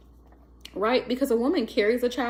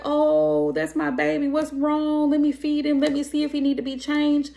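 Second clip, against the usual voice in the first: higher fussing mom voice; doting mother; 5 semitones higher than usual. Retold or imitated. Imitating a mother fussing over her baby, doting.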